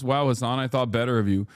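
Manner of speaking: normal talking voice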